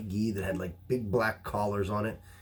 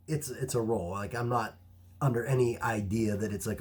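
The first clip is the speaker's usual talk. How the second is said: ordinary talk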